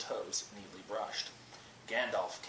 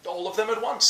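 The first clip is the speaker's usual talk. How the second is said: bright, loud and high all at once; cheerful exclaim; 11 semitones higher, 13 dB louder than usual. A narrator's reading. Bilbo beams at the wizard, loud and high. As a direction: Bilbo answers brightly, much louder and higher. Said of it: "said Bilbo".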